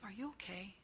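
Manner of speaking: a soft private whisper of concern. Retold or imitated. Counselor whispering to a defensive kid in her office. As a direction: lean in and whisper it kindly.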